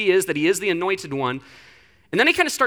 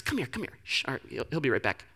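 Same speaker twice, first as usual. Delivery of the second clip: hushed, much softer aside, partly whispered; pulling aside quietly; 8 semitones lower, 11 dB quieter, faster than usual. Retold or imitated. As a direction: sneak him away in a low hush. Grabs Jesus by the arm.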